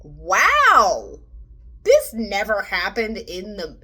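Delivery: loud, slowed-down wow of disbelief; mock amazement. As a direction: act stunned by the change. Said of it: "And I was like".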